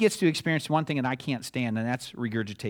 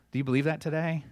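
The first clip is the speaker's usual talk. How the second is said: ordinary delivery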